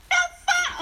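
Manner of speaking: high startled yelp. Acted. Character startled.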